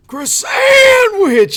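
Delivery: a loud high mock New York shout; raspy New Yorker yell. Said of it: "raspy New Yorker smoker voice".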